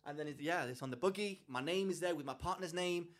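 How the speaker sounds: normal talking voice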